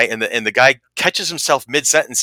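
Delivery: usual speaking voice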